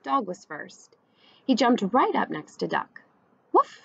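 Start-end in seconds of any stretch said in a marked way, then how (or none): 3.5-3.8 high, loud bark; a short, high doggy woof. A narrator's reading. Dog aboard beside Duck, woofing. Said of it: "said Dog".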